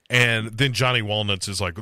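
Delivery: ordinary delivery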